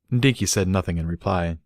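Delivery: ordinary delivery, nothing marked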